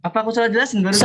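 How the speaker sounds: fast, high, loud protest; exasperated disbelief. Real, not acted. Teacher asks whether she explained it wrongly, loud exasperation. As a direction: fire off the question loudly, pitch way up.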